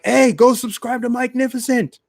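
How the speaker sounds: high squeaky mock shill voice; mock high pitched plug. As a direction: ham it up as an eager promoter. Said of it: "Do you go and be like".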